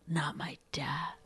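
slow whispered "not my dad"; imitating a sulky whisper; whispered aside. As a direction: hiss it under her breath. Bride muttering aside.

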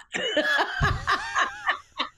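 high, long cackling laugh; laughter. Real, not acted. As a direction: crack up at your own joke. Cracks up at own impression.

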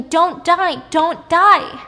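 high, loud imitated screaming; retold scream. Host acts out the avenger over the dead man, screaming. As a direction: cry it out as her desperate demand. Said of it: "she's screaming".

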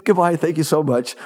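high, polite English receptionist voice; English accent. Retold or imitated. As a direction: answer the phone as a gracious English lady. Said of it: "with an English accent where she would go".

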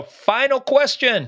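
high, slow game-show announcement; announcer voice. Pretend. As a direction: stretch it like a quiz host. Dramatic quiz-show announcement.

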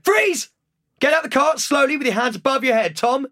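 high, urgent shouted police command; hammed-up cop taking charge; policeman. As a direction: play an officer ordering suspects out of a car. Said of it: "Give us your best policeman".

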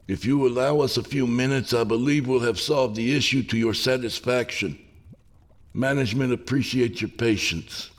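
high, even, placating spokesman; calm, soothing. A narrator's reading. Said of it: "spoke in a calm, soothing tone".

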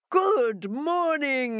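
drawn-out, high-swooping hello; jackal's greeting. Acted. Jackal walking by greets Hare, with a drawn-out hail. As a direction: stretch the greeting out, pitched well up.

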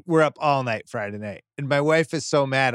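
usual speaking voice

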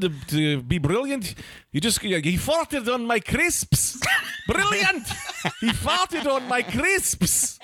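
put-on Scottish accent. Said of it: "It slips into Australian".

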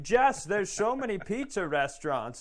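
high, coaxing plea to visit; lightly voiced needy relative begging; mock begging a guest to stay; pleading lonely grandparent.

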